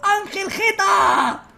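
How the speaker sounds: high, very slow stretched yell; drawn-out shout. Real, not acted. Gamer announcing a daring drop, stretched shout. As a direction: call it out like a hero's name.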